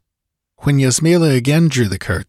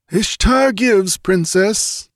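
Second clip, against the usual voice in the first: fatalistic desert chief; 11 semitones higher, slower than usual. A narrator's reading. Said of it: "The fatalism of his ancestors spoke".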